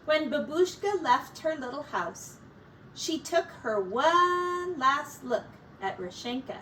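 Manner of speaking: plain reading voice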